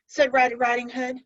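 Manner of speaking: ordinary delivery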